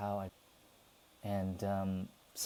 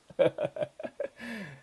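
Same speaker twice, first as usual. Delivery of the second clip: high-pitched burst of laughter; real laugh; 9 semitones higher than usual. Real, not acted. Laughs at own joke.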